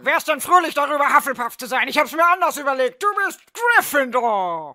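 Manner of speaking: loud berating shout; hammed-up bellowing hat; bellowed at her. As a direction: roar the verdict, drawing out the last words. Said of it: "brüllte er sie an".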